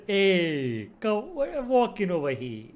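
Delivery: high-pitched, hammed-up Italian American gangster accent; mock Italian American accent. Shown as a demonstration. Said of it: "that kind of a".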